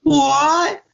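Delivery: high-pitched Cockney yelling; mock Cockney. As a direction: shout like a hammed-up Cockney lad. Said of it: "the Cockney commentary".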